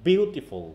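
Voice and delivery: "বিউ" stressed, "টিফুল" falling away; stress on first syllable. Shown as a demonstration. Teacher asking pupils to hear the force, "বিউ" stressed in beautiful. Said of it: "দেখো কোন জায়গায় force হচ্ছে".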